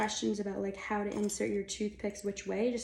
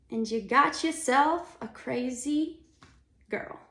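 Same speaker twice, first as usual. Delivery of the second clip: put-on New York accent; 3 semitones higher, 5 dB louder, slower than usual. Playful return to New York accent.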